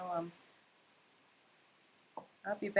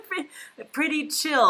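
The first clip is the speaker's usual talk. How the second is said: laughing; 8 semitones higher than usual. Real, not acted. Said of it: "You're like".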